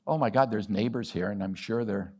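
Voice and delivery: usual speaking voice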